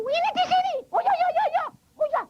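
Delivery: very high, loud screaming wife; wife screaming that the demon clings to her, high pitched. Retold or imitated. Wife again feeling the demon.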